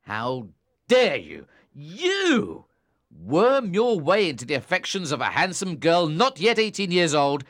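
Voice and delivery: angry tirade. A narrator's reading. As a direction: berate him harshly. Tom berating Philip.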